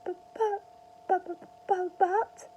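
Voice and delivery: high, loud flustered stammer; stuttering mother, high and rattled. A narrator's reading. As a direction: trip over the buts in shock. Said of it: "stammered George's mother".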